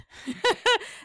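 high, light burst of laughter. Real, not acted. Guest admitting it's never about the food, laughing. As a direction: laugh at your own admission.

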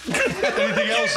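high, slow sweet question; comic toying with the heckler; mock pleasant. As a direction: ask it with fake innocence. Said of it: "He goes".

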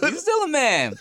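high, squealing through laughter; laughing agreement. Real, not acted. Agreeing with the co-host about Michael, laughing hard. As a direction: echo it while cracking up.

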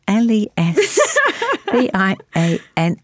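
letters spaced out one by one, slow and deliberate; slow letter-by-letter spelling; spelling it out slowly. Retold or imitated. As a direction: spell each letter carefully for a child. Spelling a word out for daughter.

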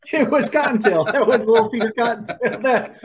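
high and loud, laughing through the words. Real, not acted. Laughing at the father being fed rabbit. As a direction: crack up while you say it.

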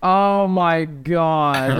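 very slow, dragged-out exasperation; exasperated drawl. Retold or imitated. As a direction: stretch it out like you can't believe them. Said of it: "And you're like".

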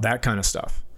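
usual speaking voice

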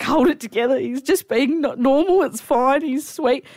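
high, tearful self-pep-talk; trying not to cry. Retold or imitated. Woman telling herself to hold together, close to tears. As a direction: talk yourself down from crying. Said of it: "trying not to cry in his face".

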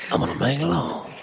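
put-on British accent, seductive; soft British. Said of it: "It's very different".

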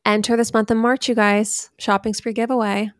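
hyped podcast announcement; ad read. Acted. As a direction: sell the giveaway like a host. Giveaway ad read.

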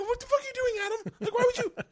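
very high-pitched disbelief; retold incredulous scolding. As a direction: squeal it as a mock reproach. Comic scolds Adam in story.

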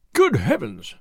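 mildly flustered nobleman's exclamation; a touch of harried alarm; distracted cry. A narrator's reading. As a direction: exclaim as a distracted lord, understated. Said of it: "cried the distracted nobleman".

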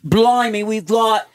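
hammed-up, drawn-out Australian announcing; Australian accent. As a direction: call out the viewers in broad Australian. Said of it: "Read 'em in your Australian accent".

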